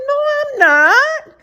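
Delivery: very high, slow drawn-out "no, I'm not"; squeaky denial. Retold or imitated. Answering the boyfriend's doubt with a high squeal.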